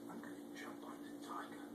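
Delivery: whispered ladybug plan; hushed little bug. Acted. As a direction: breathe it like a secret.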